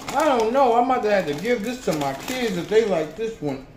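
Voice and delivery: speaking between bites; talking while chewing. Real, not acted. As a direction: mouth full of popcorn. Seen 1: Hand brings popcorn to mouth at 1.8s, then chews while talking.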